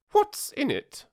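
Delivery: imperious queen. A narrator's reading. Queen demands.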